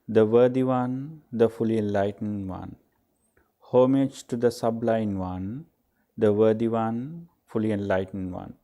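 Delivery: measured devotional reciting in repeated cadences; liturgical recitation. Real, not acted. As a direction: recite it as a prayer, lifting phrase endings, then settling. Recites the homage in English.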